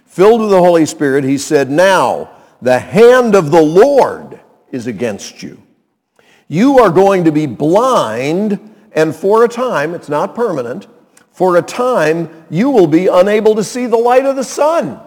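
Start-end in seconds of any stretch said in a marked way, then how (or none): 1.7-9.9 loud, slow, weighty prophetic pronouncement; pronouncing judgment. Acted. Paul, filled with the Holy Spirit, to the false prophet, pronouncing judgment. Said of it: "and pronounced judgment". 11.3-15.0 raised, forceful prophetic declaration; pronouncing judgment. Acted. Paul to the sorcerer about coming blindness, pronouncing judgment. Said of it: "and pronounced judgment".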